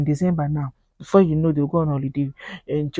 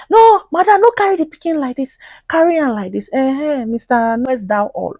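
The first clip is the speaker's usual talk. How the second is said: high loud nagging Pidgin busybody voice; shrill-free mock scolding auntie; 8 semitones higher, 8 dB louder than usual. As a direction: parody a meddling know-it-all, pitched way up. Mocking busybody advising mother.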